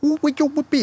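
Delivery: baby talk impression. Host mocking the boxer's put-on voice, high and cooing. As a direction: coo it like talking to a baby. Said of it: "he's talking to a baby, making baby sound".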